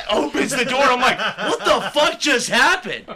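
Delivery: high, loud, laughing; laughing disbelief. Real, not acted. Retelling the backhand, laughing loud and high. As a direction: laugh through the outrage.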